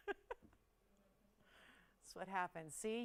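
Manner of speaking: normal talking voice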